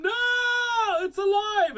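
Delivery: high, loud shriek of horror; lady's scream. A narrator's reading. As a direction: scream it out in alarm. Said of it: "The lady screamed".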